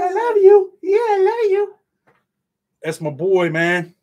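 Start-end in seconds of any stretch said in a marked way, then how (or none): 0.0-1.7 very high and louder, cooing. Real, not acted. Dad coaxing baby to say it.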